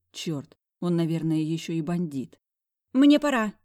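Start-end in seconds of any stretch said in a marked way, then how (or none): 2.9-3.5 sudden high yelp; blurted out. Acted. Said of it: "вякнула я".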